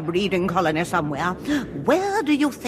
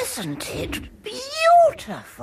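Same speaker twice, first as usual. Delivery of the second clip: awed; 10 semitones higher, much slower than usual. Acted. Awed at the dragon.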